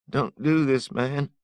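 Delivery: high, loud desperate begging; a ragged thief pleading at gunpoint. Acted. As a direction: beg for your life in a strained high voice. Thief pleading for his life.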